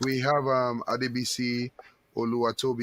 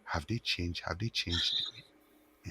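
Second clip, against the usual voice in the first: hushed test; 5 semitones lower, 7 dB quieter, slower than usual. Shown as a demonstration. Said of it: "I'll whisper".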